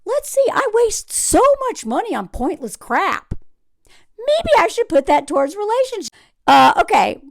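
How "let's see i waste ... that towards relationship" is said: higher, put-on musing voice; mock pondering dude. Host imitating the tech bro pondering his spending, mock musing. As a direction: mimic a guy thinking aloud. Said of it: "And this dude's like".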